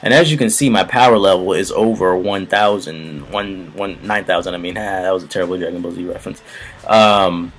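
mic input cranked up. Shown as a demonstration. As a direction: blast it into the red zone. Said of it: "I'm turning it up".

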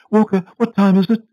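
high, loud, fretful lady's voice; a nervous wife calling to her butler; anxious Mrs Foster. A narrator's reading. Anxious wife asks the butler the time, high and fretful.

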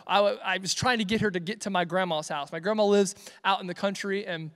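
ordinary talk

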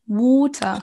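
"water" said "wota" in a British accent; slow British "water", no R. Shown as a demonstration. Teacher demonstrating the British "water" to French learners. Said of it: "Les Britanniques vont dire".

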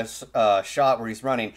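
usual speaking voice

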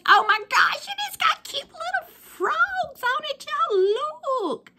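3 coo over the pen like a treasure; 1 squealing delight. Real, not acted.

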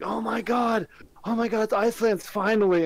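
high excited retold gasp. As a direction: gush with relief. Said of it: "I was like".

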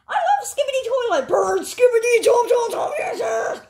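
high, loud fan cheering; excited shouting. Acted. Courtroom fan yells his love for the show, high and loud. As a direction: scream it out like a crazed fan. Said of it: "Sorry, I was so loud".

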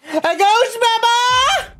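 very high, drawn-out squeal; hammed-up squeal of disbelief; mock shock. Joking outburst, then just kidding.

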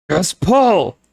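high, loud wail. Acted. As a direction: wail at her friend. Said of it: "Carly Beth wailed".

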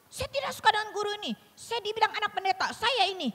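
put-on high, quick complaint of a cross child; angry child. Child home from school complaining about the teacher, angry. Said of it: "anak saya kadang pulang marah".

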